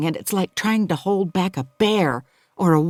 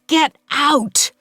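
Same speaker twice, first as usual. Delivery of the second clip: sharp high shout at the intruder; acted shouted "Get out!"; 10 semitones higher, 4 dB louder than usual. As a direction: bark it to drive her away. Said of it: "I'm shouting".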